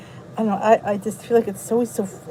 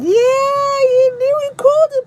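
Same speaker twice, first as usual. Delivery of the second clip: mock wail; 14 semitones higher, 5 dB louder, slower than usual. Real, not acted. Viewer guessed right, mock wail.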